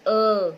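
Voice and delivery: a long steady अ; held vowel sound. Shown as a demonstration. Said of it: "बिना रुके मेरी आवाज बाहर आ रही है".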